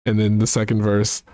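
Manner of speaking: normal talking voice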